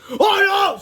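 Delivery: high panicked shout; loud frightened cry. A narrator's reading. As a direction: yell it out in terror as you fall. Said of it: "shouted Cheese Ball".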